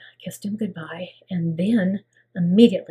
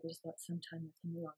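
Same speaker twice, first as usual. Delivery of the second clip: quiet retold request; 20 dB quieter, faster than usual. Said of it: "they said".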